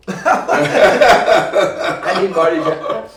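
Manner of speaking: slow loud words through laughter; laughing. Real, not acted. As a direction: squeeze it out while cracking up.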